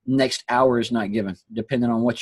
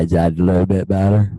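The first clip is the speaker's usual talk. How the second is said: like a drive-through speaker box; speaker-box sound; 2 semitones lower, 6 dB louder than usual. Real, not acted. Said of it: "you sound like the drive-through menu person".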